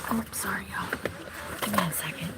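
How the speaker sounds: low whisper to herself; quiet whispered aside. Real, not acted. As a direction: keep it hushed in the car. Whispering near sleeping kids.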